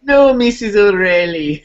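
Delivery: light Irish leprechaun lilt; hint of a Lucky Charms leprechaun. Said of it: "Sound like, sound like the fucking, uh, the fucking leprechaun from Lucky Charms".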